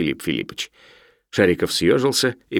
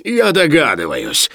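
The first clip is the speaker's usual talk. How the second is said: slow, raised, venomous realisation; spiteful exclamation; 6 semitones higher, 4 dB louder, slower than usual. A narrator's reading. Professor guesses who lent the book, angrily. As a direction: let the anger seep through each syllable. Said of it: "злобно краснея воскликнул Филипп Филиппович".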